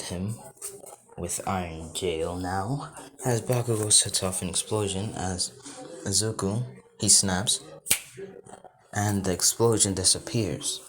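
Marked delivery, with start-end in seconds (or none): none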